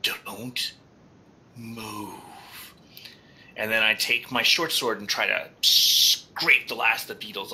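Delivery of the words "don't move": hushed in-character warning; whisper. Acted. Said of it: "I will whisper in".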